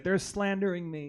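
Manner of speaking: indignant star voice. Retold or imitated. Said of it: "She's like".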